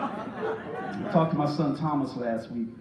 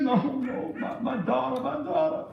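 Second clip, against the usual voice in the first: high, halting imitation of a tearful mother; mock sobbing; 7 semitones higher than usual. Mother confiding in the preacher about her daughter, mock sobbing. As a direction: stammer it out in pretend tears. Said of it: "She was crying so vehemently".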